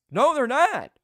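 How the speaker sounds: high, loud burst of disbelief; surprised outburst. Real, not acted. Commentator shouting when refs skip the review. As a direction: blurt it out, shocked.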